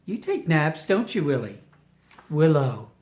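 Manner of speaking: plain reading voice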